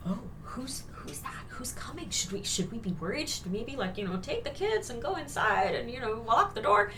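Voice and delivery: furtive, uneasy undertone; worried villagers. Acted. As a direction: act out wary townsfolk whispering among themselves. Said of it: "everyone is like".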